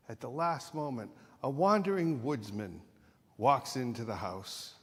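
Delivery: ordinary talk, nothing marked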